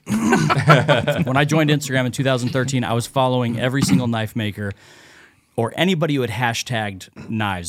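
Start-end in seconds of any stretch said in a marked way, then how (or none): 0.1-2.8 laughing. Real, not acted. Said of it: "what's it say on my hat?".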